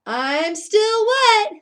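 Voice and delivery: high, loud, slow call; yell. Acted. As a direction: stretch it out for the kids. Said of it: "yelled Bullfrog".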